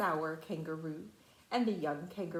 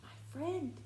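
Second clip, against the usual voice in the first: tiny far-off voice; 6 semitones higher, 6 dB quieter than usual. Acted. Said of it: "came the voice".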